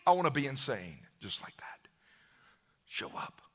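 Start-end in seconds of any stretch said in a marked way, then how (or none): 1.2-3.4 breathy hushed whisper. Real, not acted. Preacher whispering to the congregation, whisper. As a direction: let it fall to a whisper.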